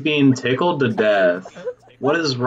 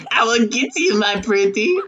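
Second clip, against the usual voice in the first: witch voice; 10 semitones higher than usual. Acted. Witch quote continues the joke.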